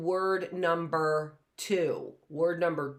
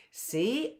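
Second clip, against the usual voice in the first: normal talking voice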